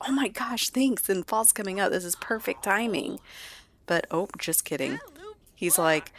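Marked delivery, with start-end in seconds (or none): 0.1-3.2 high, delighted gush of thanks; feigned excitement. Acted. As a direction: gush as if thrilled by the gift. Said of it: "I was really excited".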